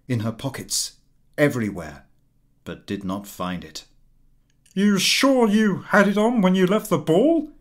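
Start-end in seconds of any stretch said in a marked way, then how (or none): 4.7-7.5 high, loud anxious question; husband searching for the lost necklace; husband asking urgently. A narrator's reading. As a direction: press her for an answer. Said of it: "he asked".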